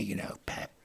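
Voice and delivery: hushed, breathy-free whisper of a rock star. Retold or imitated. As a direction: whisper it like a burned-out rocker. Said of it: "He says to me".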